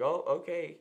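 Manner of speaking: put-on, loudly irritated comeback; mock exasperation. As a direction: act out being fed up with the assistant. Acts out annoyed reply to Siri.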